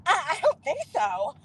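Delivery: imitating her haughty brush-off; snooty mock refusal. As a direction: put on her dismissive little voice. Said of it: "Like that".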